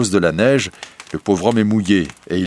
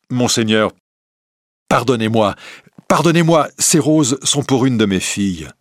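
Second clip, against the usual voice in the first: begging; 4 semitones higher, 4 dB louder than usual. Acted. Merchant begs on his knees.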